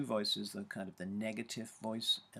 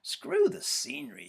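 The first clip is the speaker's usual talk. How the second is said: much higher and louder, scoffing; mock scornful outburst; 7 dB louder than usual. Runner's inner critic scoffing at the scenery, loud and high. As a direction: blurt it out in scorn. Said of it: "The pisser".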